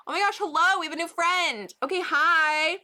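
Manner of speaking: high, loud delighted hello; light up for a new friend; excited welcome; excited greeting. Real, not acted.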